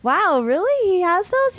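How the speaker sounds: high-pitched mock amazement; retold surprise. Retelling own surprised reaction.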